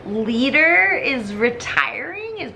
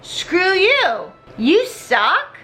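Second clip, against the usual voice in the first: high annoyed snap; 8 semitones higher, 3 dB louder, much slower than usual. Retold or imitated. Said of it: "Elijah's just like".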